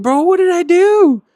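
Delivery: loud, high bewildered cry; plead, baffled and loud; confused protest. Retold or imitated. You asking your upset friend, loud high bewilderment. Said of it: "You're like".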